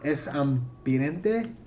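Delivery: "Es ambiente" spoken very slowly, Spanish "es" said like "ess"; reading foreign language. Shown as a demonstration. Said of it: "shouldn't it be es?".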